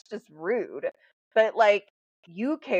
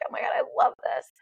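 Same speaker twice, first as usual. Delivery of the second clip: whispered, breathless fangirl gush; 6 semitones higher, faster than usual. Retold or imitated. Meeting Daly, whispering her fangirl excitement. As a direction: hush it like a giddy secret.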